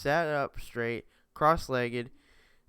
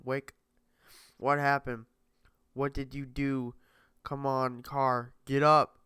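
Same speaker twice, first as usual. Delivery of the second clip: urgent calling; 7 dB louder than usual. Acted. Maze shaking him awake.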